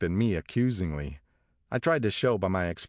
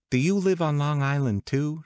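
high, lightly curious question; inquiring; 9 semitones higher, 3 dB louder than usual. A narrator's reading. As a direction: ask it as high, social small talk. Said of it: "she inquired".

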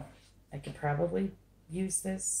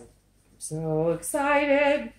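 high, loud, stretched-out squeal of excitement; slow, high sing-out of excitement; excited; 8 semitones higher, 5 dB louder, much slower than usual. Real, not acted. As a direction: draw it out with giddy glee.

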